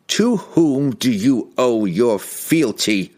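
slow, commanding wizard demand. A narrator's reading. Wizard demanding loyalty from the king, slow and commanding. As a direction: force the answer out of him. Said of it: "he demanded".

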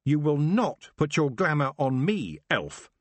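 Tiffany resisting the elf's glamour, shouting; screamed defiance. Acted. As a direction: throw off the spell with a yell. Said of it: "She screamed".

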